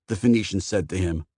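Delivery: plain reading voice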